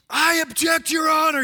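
high-pitched yelled protest; yelled courtroom objection. Acted. Attorney objecting in court, yelling. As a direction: yell it out as an indignant courtroom objection. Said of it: "stands up and he yells".

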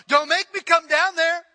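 shouted mother's threat; mom yelling. Retold or imitated. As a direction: yell it down the stairs like mom. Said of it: "she'd yell".

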